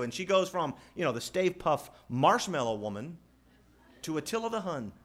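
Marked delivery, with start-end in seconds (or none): none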